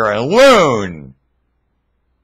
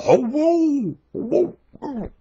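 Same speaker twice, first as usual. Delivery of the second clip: much higher, hushed, breathy amazed cooing; little theater; 13 semitones higher, 5 dB quieter, much slower than usual. Pretend. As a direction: play the baby in the womb, marvelling. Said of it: "I'm trying to put on a little theater here".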